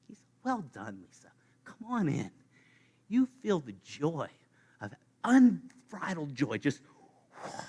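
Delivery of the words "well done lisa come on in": soft, whispered welcome, the last words breathed; hushed welcome. Pretend. God quietly welcomes Lisa into heaven, whispered and tender. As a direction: gently whisper come on in.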